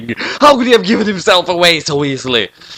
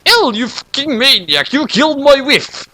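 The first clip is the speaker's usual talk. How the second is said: yelled; 11 semitones higher than usual. Acted. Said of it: "Light's dad yelled as loud as he could".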